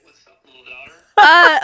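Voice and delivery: dad over speakerphone; phone speaker. Real, not acted. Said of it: "you're on speaker right now".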